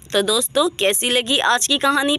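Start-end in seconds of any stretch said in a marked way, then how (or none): none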